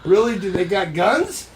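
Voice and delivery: put-on a touch of childish amazement; surprised kid. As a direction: gasp it out like a curious boy. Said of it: "he said".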